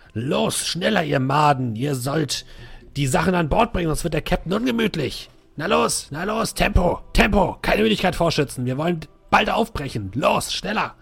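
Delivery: high, barking drill-sergeant shouts; yelling ship officer. Acted. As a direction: drive the crew on, bellowing. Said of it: "er brüllt den ganzen Laden zusammen".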